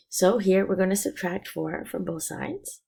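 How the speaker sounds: normal talking voice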